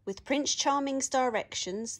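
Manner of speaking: usual speaking voice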